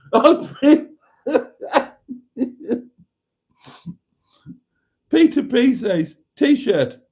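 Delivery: high-pitched helpless laughing fit through the words; can't stop laughing, squeaking out the words; real laughter. Real, not acted. Reading a viewer's joke aloud, breaking into high laughter. Cracking up at Hubnutted comment.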